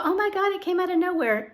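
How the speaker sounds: mock surprised person. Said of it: "And then people go".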